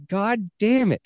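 hammed-up annoyance, climbing up at the end; frustrated cursing. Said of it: "and I was like".

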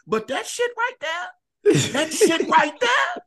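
slow, very high wail; comic dismay. Real, not acted. Smoker telling friends about the strong stuff, comic dismay. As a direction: draw each word out in a high whine.

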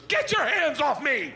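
high, loud, drawn-out protest; angry daughter's yell. Retold or imitated. Daughter yelling at dad who grabbed her, high and loud. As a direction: shriek at dad to let go. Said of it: "she goes".

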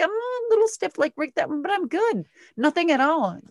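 high-pitched mock breeziness; feigned nonchalance. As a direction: brush off the pain too brightly. Pretending not to hurt.

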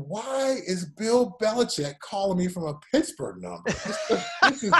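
acted-out bafflement, drawn out at the end; baffled thought. Player wondering about a mystery caller, comically baffled. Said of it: "And I'm thinking".

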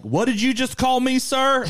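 high, loud, indignant Southerner; Southern accent. Acted. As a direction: take offense in a hammed-up Southern accent. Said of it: "always a Southern accent we use".

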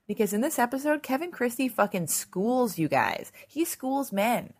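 ordinary talk, nothing marked

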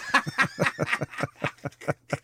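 silent breathy laughter; wheezing laugh into the mic. Real, not acted. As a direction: laugh hard with hardly any voice. Bursts out laughing.

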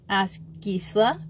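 plain reading voice

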